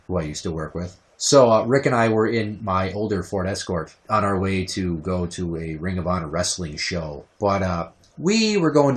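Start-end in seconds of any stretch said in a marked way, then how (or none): none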